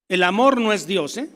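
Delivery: normal talking voice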